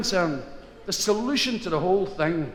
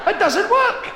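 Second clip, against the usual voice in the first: high, loud wail; hammed-up yelp of frustration; comic desperation; 5 dB louder, slower than usual. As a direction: cry out in mock despair. Itch sufferer's failed attempt.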